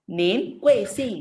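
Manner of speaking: slow "Nin" rising, "Kuing Sing" dropped hard and loud; rising then angry falling. Shown as a demonstration. Teacher models for pupil, "Nin" up, "Kuing Sing" sharply down. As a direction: lift "Nin", then drop each word of "Kuing Sing". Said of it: "nin itu kan naik kan".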